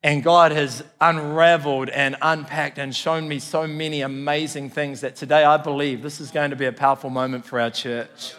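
ordinary delivery

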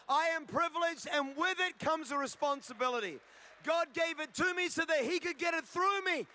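rally the church from the pulpit; fired-up sermon delivery; full-force preaching. Acted.

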